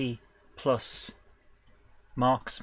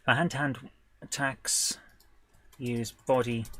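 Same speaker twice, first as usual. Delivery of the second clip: usual speaking voice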